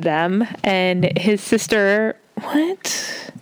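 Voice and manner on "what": whispered, high mock German "what?"; a quick high, hushed German-accented aside. Host jokes to her co-host in a mock German whisper. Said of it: "that was an attempt at a German accent".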